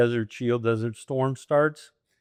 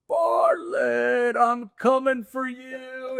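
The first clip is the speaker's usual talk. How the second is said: high shout of the name, then a slow drawn-out threat; a runner shouting to his buddy from the hilltop; playful call out; 14 semitones higher, much slower than usual. Retold or imitated. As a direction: yell it down the hill at your rival. Calling out to rival downhill.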